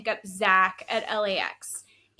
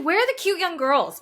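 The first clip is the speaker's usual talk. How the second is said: high, louder, hammed-up eager question; mock excited ask; 8 semitones higher, 6 dB louder than usual. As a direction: play up the giddy request for cute girls. Retelling her own eager request.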